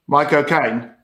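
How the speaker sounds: "My cocaine" run together like a Cockney saying "Michael Caine"; Cockney-style "My cocaine" in a Caine accent. Shown as a demonstration. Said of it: "Michael Caine's name in his own accent".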